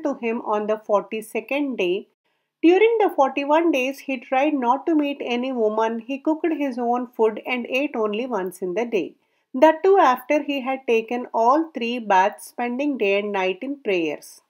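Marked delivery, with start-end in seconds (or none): none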